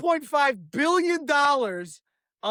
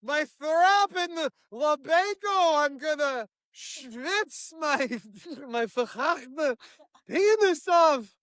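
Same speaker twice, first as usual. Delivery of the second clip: hammed-up Israeli; 3 semitones higher, slower than usual. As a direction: stretch it out like a ranting Israeli agent. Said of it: "Closes the door".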